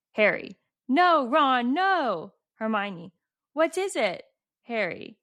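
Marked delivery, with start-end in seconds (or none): 0.9-2.3 high, louder read-out plea; dramatic protest. Retold or imitated. Reading Harry's plea to Ron at the chess match, dramatic. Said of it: "they're so dramatic". 3.5-4.2 high-pitched acted question; dramatic question. Reading Hermione's question in the chess scene, dramatic. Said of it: "they're so dramatic".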